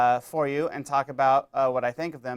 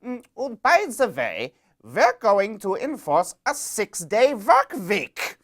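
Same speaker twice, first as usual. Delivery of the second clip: loud, high-pitched German officer caricature; comic German accent, drawn-out and loud; mock German accent; 7 semitones higher, 6 dB louder, slower than usual. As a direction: announce it slowly in a hammed-up German accent. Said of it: "if those Nazis came into France and were like".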